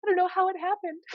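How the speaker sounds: very high, mock-helpless squeal; imitating a video character. Pregnant granddaughter explaining herself to Nana, mock-helpless high voice. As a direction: mimic her cluelessness in a high little voice. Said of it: "She's like".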